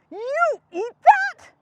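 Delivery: drawn-out disbelief; Gerald voice. Acted. Gerald asking Piggy in disbelief, slow appalled voice.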